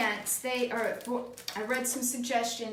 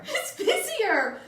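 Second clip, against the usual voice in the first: laughing; 11 semitones higher, 5 dB louder, much slower than usual. Real, not acted. Laughing at surprise taste.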